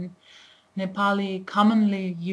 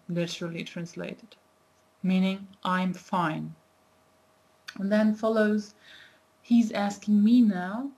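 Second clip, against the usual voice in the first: normal talking voice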